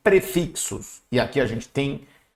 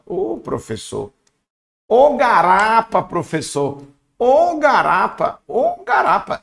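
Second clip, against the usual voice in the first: drawn-out "Ô, garapa", rising higher and louder; slow, stretched mock exclamation; gleeful student; 5 semitones higher, 3 dB louder, slower than usual. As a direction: play a pupil crowing that it's easy. Mock student cheering an easy answer.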